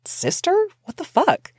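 Sister?! What the... in disbelief; shocked outburst. Retold or imitated. To Paul about his sister, shocked disbelief.

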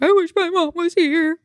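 put-on high, small voice of a sad four-year-old; little boy voice. Son telling his aunt he misses mom, in a high little voice. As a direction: play the kid missing his mom. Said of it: "he's like".

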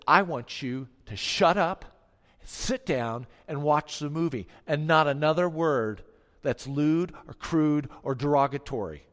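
stern rebuke. Retold or imitated. Confronting rude men in theater.

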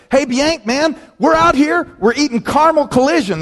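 high, cheery buddy calling from the lake; bubbly high buddy voice; excited buddy on the phone. Retold or imitated. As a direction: chirp it like a happy friend on holiday. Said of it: "He says".

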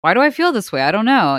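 high, loud, clueless complaint; baffled whine. Retold or imitated. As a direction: play your confused old self. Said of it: "old me would have been like".

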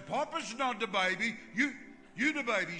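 mock indignant grandpa.

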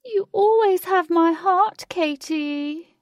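high, slow, loud villain's cry; echoing call. A narrator's reading. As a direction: call grandly after someone escaping, words held long. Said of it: "Lutz's voice echoed everywhere".

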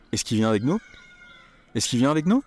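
"Est-ce qu'il" said as "ess-ki", the L dropped; casual spoken French. Shown as a demonstration. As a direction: say it like a native, quick and run together. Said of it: "Il becomes I".

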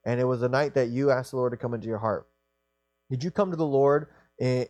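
ordinary delivery

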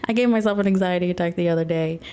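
rattled off fast, a bit embarrassed; quick repeat. Real, not acted. Repeating her anxiety attack confession to viewers, fast and rushed. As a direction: blurt it out again quickly. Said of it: "repeats the line".

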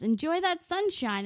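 high-pitched cashier send-off; put-on high clerk voice; checkout clerk. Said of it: "She says".

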